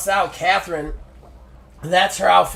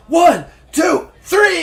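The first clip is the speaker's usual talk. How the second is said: shouted count, much higher and louder; referee count; 12 semitones higher, 9 dB louder, slower than usual. Acted. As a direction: bang out the pin count like a ref.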